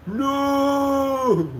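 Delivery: very high drawn-out mock no; hammed-up goat terror; goat's scream. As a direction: cry out like a doomed goat. Goat's horrified cry.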